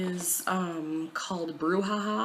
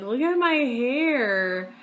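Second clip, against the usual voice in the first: mock horror; 7 semitones higher, 8 dB louder, much slower than usual. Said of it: "I'm like".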